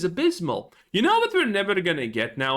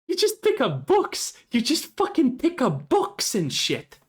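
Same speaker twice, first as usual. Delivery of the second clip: mocking whine; 7 semitones higher than usual. Mocking the blood elf quests.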